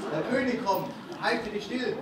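urgent call. Acted. Cat urgently warns.

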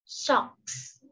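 Socks whispered again; whispered repeat. Shown as a demonstration. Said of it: "Những chiếc tất chúng ta đọc là". (thì thầm lặp lại Socks)

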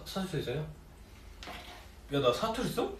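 normal talking voice

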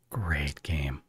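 soft breathy whisper. Real, not acted. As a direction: whisper it fondly. Whispered praise.

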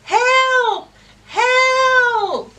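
high, loud yell. Acted. Reader and child yell for the duck, loud high. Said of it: "Let's yell that".

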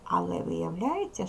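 normal talking voice

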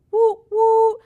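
imitated horn blasts, high and loud; high loud horn toots. A narrator's reading. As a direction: toot it like a party horn.

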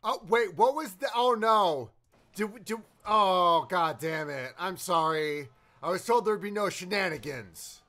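loud, flustered complaint; real exasperation. Real, not acted. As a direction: react aloud to a broadcast going wrong. Host's broadcast suddenly hacked.

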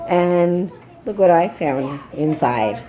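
usual speaking voice

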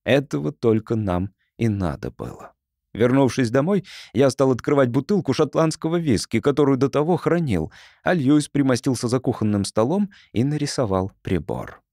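ordinary delivery

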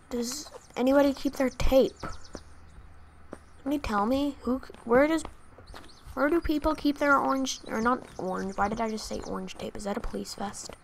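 normal talking voice

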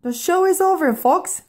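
high-pitched call to the audience; hurried showman's sign-off; excited announcement. A narrator's reading. Marco hurriedly ends the show.

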